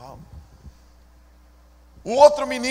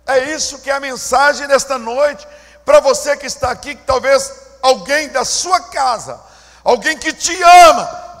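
louder, rising sermon appeal; preaching at full force; 5 semitones higher, 10 dB louder than usual. Acted. Pastor applying the message to his listeners, louder preaching.

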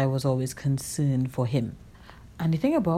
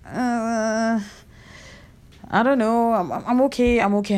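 higher, louder, stammering nervous denial; nervous brush-off; 7 semitones higher, 3 dB louder than usual. Retold or imitated. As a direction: fake being fine while rattled. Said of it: "And I'd be kinda like".

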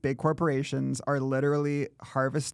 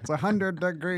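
higher, lightly put-on Trump voice; a clumsy Trump, half-hearted; Trump impression; 5 semitones higher than usual. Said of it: "that's like a mid-Atlantic act".

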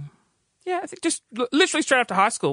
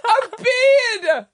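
very high, loud drawn-out shriek; indignant shriek; 7 dB louder, much slower than usual. Acted. Protesting to the elderly lady, hammed-up outraged shriek. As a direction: shriek it in comic outrage, stretching the words.

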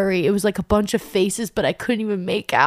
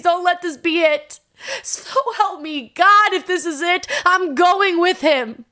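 higher, louder, desperate begging; tearful pleading prayer; 8 semitones higher, 4 dB louder than usual. Retold or imitated. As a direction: plead with God through tears, straining upward. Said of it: "I start hysterical crying".

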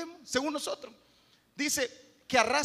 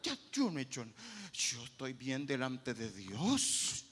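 self-righteous denial; 9 semitones lower, 5 dB quieter than usual. Retold or imitated. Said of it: "creen que es la correcta".

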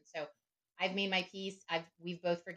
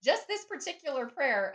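high, loud quoted reply; acted retort; 9 semitones higher, 8 dB louder than usual. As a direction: answer back in a raised, heightened voice. Acts out her own sheepish reply.

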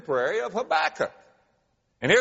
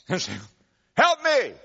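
mock cry for help; 7 semitones higher, 3 dB louder than usual. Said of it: "cry for help, somebody who's sinking".

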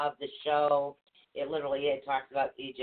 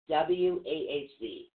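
ordinary talk, nothing marked

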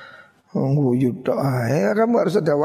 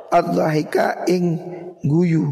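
usual speaking voice

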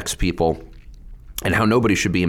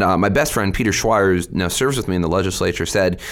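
normal talking voice

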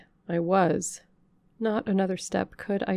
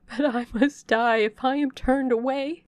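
higher, louder desperate plea; despairing cry; 7 semitones higher, 5 dB louder than usual. Acted. Stranger to the servant about to shut her out, despairing cry. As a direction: beg for your life.

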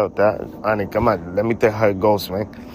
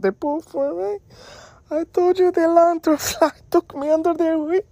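high squeaky voice through laughter; shrill laughing protest. Real, not acted. Laughing disbelief at partner.

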